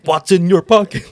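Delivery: loud, high, irritated demand; annoyed security guard. Retold or imitated. Airport guard snapping at a confused traveler, loud and irritated. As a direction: bark the question like a fed-up guard.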